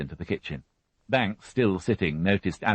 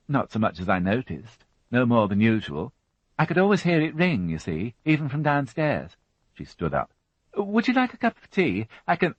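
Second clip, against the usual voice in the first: lightly raised fussy housewife voice; a higher, brisk widow answering the policeman; Mary Rothwell voiced; 7 semitones higher than usual. A narrator's reading. Widow answering the inspector, a higher fussy voice.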